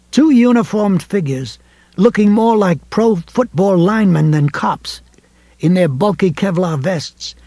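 ordinary delivery

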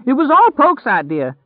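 terrified scream. A narrator's reading. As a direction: scream it out in blind panic. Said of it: "he screamed".

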